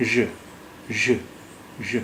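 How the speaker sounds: ژ alone, a voiced buzz between sh and z; zh sound demo. Shown as a demonstration. Teacher isolating the Urdu sound between sha and za, ژ as zh. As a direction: sound out ژ as a soft zh, piece by piece. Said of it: "وہ ژ کی ہوگی".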